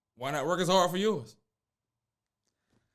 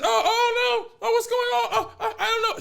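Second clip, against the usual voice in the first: put-on hysterical girlfriend; 4 dB louder than usual. Caught girlfriend playing dumb, hammed-up high hysterical squeal. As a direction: ham up her fake hysteria. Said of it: "acting all hysterical".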